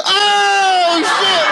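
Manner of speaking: high, loud shocked cry; shocked kids. Retold or imitated. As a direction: yelp like a startled little kid. Kids shocked in back seat.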